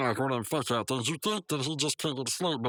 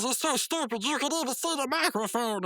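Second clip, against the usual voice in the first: high whiny complaint; 9 semitones higher than usual. Retold or imitated. Rigby complaining shrilly.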